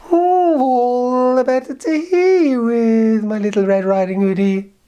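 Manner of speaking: very high, slow, sugary granny voice; a wolf hamming up a croaky old lady; wolf as sweet granny. Acted. Wolf answering the girl's question: slow, very high fake granny voice.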